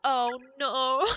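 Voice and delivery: loud "oh no" breaking into a teary laugh; watching a character die and it hurts; real dismay. Real, not acted. Streamer cries out at a sad death, half laughing.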